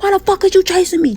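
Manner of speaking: high panicked comeback; retold panicked yell. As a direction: shout it over your shoulder while running. She shouts back while fleeing.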